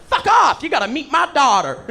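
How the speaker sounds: high, loud excited outburst; thrilled by the English accent, loud and high; excited Ohio local. Retold or imitated. Ohio local meets an Englishman, loud excited yelp.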